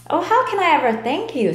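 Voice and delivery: high, fast gushing little fly; lightly voiced eager fly; grateful fly. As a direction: gush thanks like a tiny fly.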